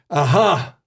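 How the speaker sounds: imitated Glasgow detective's Scottish exclamation; heavy Scottish accent. Retelling the Glasgow detective's realisation, in a heavy Scottish accent. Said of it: "in a heavy Scottish accent".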